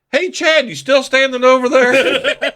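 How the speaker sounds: high, loud call to Chad across the shop. Retold or imitated. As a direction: shout it over to him by the door. Calling across the store.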